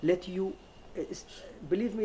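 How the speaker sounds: ordinary talk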